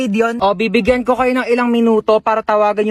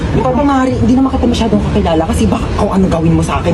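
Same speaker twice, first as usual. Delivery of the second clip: louder, brassy neighborly banter; loud brash neighbor; 2 semitones lower, 6 dB louder than usual. Acted. Nosy neighbors loudly greeting the newcomer with food. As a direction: holler across the yard.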